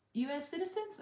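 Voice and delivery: a lightly voiced officer's sing-song question; officer calling out. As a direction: sing it out like a cheerful border officer.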